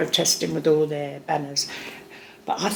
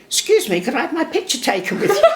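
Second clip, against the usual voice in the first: higher, laughing mock politeness; raised, giggly polite voice; cheeky polite request; 10 semitones higher than usual. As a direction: ask sweetly, barely holding in a laugh. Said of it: "I went".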